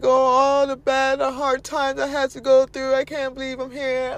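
mock woe. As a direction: overplay someone dwelling on past hardship. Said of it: "be like".

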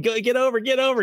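high-pitched mock team yell; retold yelling. Coach's team willing the ball over the fence, mock yell. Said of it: "So we were yelling".

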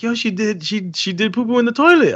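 high-pitched imitation of an excited partner; excited girlfriend imitation. Girlfriend telling dad the potty news, excited high imitation.

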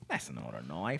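put-on low, soft Australian accent, rising at the end; put-on Australian movie-hero accent; Crocodile Dundee. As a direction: quote it in a hushed mock Australian. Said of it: "I just wanna quote Crocodile Dundee".

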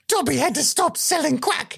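high, squeaky elf confession; Dobby impression. Dobby confessing his past trade.

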